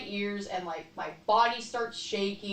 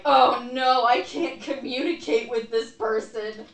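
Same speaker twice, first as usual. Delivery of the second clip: louder, lightly higher panicked inner voice; mock dismay; 4 semitones higher, 8 dB louder than usual. Retelling her panic when a stranger signs at her, louder and dismayed. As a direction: blurt it like a stuck, overwhelmed thought. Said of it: "and I'm like".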